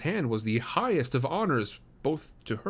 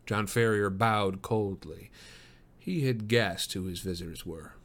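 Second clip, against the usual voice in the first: usual speaking voice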